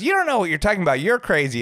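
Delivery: high, snippy imitation; mock insulting housewife. Reality star to DJ, high snippy mimicry. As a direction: snap back like a rude guest.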